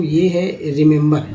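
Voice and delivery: normal talking voice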